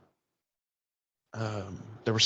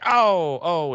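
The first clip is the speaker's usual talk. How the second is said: loud, raised cry of aversion; pained "oh"; 3 semitones higher, 4 dB louder than usual. Acted. Host hearing the bad accent, pained recoil. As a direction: wince out loud as at nails on a chalkboard. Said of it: "nails on a chalkboard".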